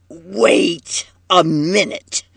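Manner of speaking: slow, forceful halt; slow, seething order to stop; angry command. A narrator's reading. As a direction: stretch each word as a threat. Enraged Marky stops them leaving.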